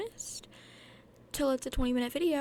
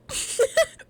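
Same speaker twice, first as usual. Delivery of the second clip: burst of laughter; 13 dB louder than usual. Real, not acted.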